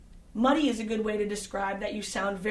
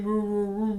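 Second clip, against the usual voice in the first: mock boom; booming imitation. Shown as a demonstration. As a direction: imitate a booming bass sound. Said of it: "bassy, booming".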